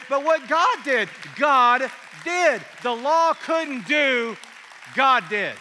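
loud, rising preacher's call; preaching at full force. Acted.

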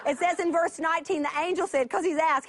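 ordinary delivery

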